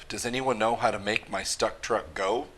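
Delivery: plain reading voice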